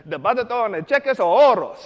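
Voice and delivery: overdone Spanish recital; eager learner. Retold or imitated. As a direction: proudly belt out the phrase just learned. Learner repeats the phrase proudly.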